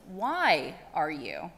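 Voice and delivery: slow, drawn-out riddle; a slow, measured owl; slow owl question. Acted. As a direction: hold each word like a patient owl.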